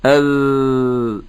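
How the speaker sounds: "أذ-" drawn out with a buzzing voiced ذ. Shown as a demonstration. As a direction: hold the ذ in "أذ-" and let it buzz. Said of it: "ما هو الفرق".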